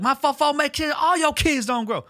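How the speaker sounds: channel [name], spitting the diss high and hard; [name] impression. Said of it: "I'd be so [name]".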